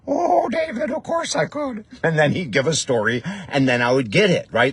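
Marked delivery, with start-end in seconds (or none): 0.1-1.8 put-on high, cheerful Indian accent; Indian accent impression. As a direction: answer brightly as the guru in Indian accent. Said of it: "his answer was the same".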